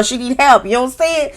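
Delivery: ordinary talk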